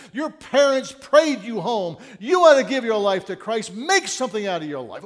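loud, rising pulpit preaching; booming revival-style exhortation; fiery preaching. Retold or imitated. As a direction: preach it hard at a wayward brother, slowing down on the big lines. Acted sermon dictated on tape.

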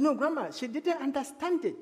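high, soft, pleading objection; acted-out worried contradiction; retold protest. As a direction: protest to grandma, pitched well up.

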